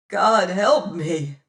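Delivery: slow, loud anguish; anguished cry. Acted. Martin cries out in torment, slow and loud. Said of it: "cried I".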